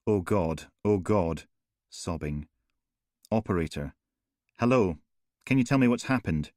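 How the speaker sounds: plain reading voice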